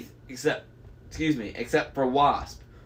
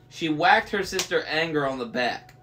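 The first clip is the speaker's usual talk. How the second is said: usual speaking voice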